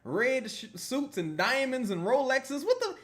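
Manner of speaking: hammed-up fan asking if he hit the lottery; mock amazed fan. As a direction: play a stunned fan who can't believe it. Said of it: "every day I'll be like".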